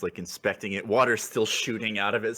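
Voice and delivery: ordinary talk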